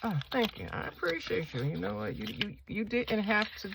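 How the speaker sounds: high, soft mock gratitude; playful thanks. Vlogger thanking her stickers for cooperating, playful thanks.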